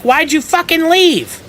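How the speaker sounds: very high, slow furious shriek; angry yelling. Retold or imitated. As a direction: shriek the question at him. Boss yelling at him.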